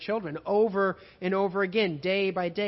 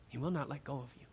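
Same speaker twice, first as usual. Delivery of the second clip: usual speaking voice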